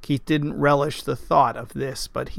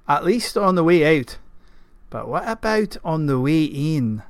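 burly Scottish knight's gruff voice; gruff Scotsman. A narrator's reading. Gawain asks Keith about tracking, gruff Scottish voice. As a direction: play a gruff Scotsman sounding out his companion. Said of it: "gruff voice that matched his exterior".

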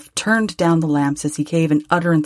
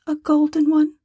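put-on higher, gentle voice of a grieving young woman; young girl, sorrowful; 11 semitones higher than usual. A narrator's reading. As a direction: lift into a tender girl's voice, close to tears.

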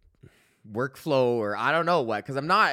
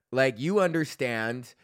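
usual speaking voice

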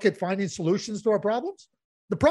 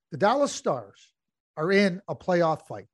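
normal talking voice

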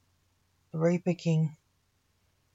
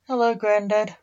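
higher, louder resentful greeting; 3 semitones higher, 5 dB louder than usual. Acted. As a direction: grandson resents grandad for taking his wife. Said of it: "resentfully blaming him".

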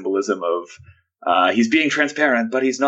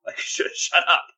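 sharp hissed shush into a whisper; whispered, impatient hushing; Hamlet shushing; 8 semitones higher, slower than usual. Acted. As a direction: shush him in a mock whisper. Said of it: "Hamlet then is, is the one who just like".

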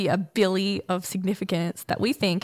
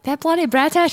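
bad Irish accent; 8 semitones higher, 4 dB louder than usual. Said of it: "that's not a good Irish accent".

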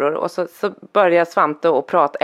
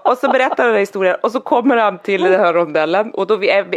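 ordinary talk, nothing marked

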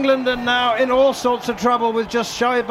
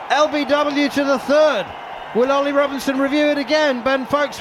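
raised live call of the appeal; excited commentary; 4 dB louder than usual. Real, not acted. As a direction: call the decision with rising excitement.

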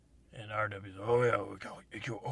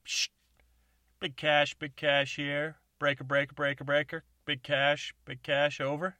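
over CB radio; 5 semitones higher, 11 dB louder than usual. Acted. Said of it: "He's like".

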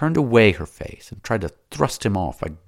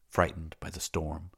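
plain reading voice

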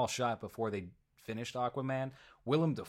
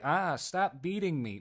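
mock victim's cry, slightly drawn out and higher; Amber Heard; 7 semitones higher, 4 dB louder, slower than usual. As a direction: put on a hammed-up plea, pitched up. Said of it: "and was like".

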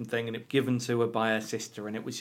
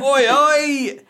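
loud, high Essex workman shout; Essex accent; 13 semitones higher, 10 dB louder than usual. Retold or imitated. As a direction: bellow it like an Essex builder. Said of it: "That was my line".

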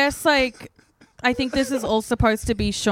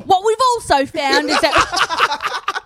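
slow, higher, louder put-on UK reading voice; 7 semitones higher, 6 dB louder, slower than usual. Reading the magazine to friends in a slow put-on UK voice. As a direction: drag out a mock British reader. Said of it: "before she starts talking the U K".

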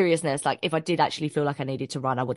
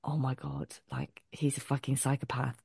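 much softer, hushed inner panic; inner thought; 3 semitones lower, 10 dB quieter than usual. Retold or imitated. Walking to the cinema, hushed panicked thought. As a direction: think it under your breath in dread. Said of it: "I'm thinking".

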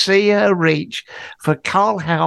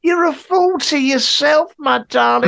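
mock tender scolding; 7 semitones higher than usual. Teller lecturing Kim fondly.

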